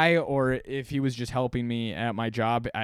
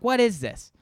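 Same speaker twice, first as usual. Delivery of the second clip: raised, louder indignant customer act; mock outrage, pitched up; imitated powerful person; 4 semitones higher, 6 dB louder than usual. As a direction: protest like someone who thinks they're important. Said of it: "speaking like a, like a powerful per".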